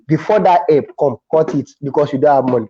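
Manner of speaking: normal talking voice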